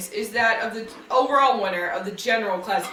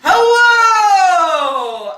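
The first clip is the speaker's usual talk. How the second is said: loud host greeting; 7 semitones higher, 8 dB louder than usual. Acted. Host's welcome back.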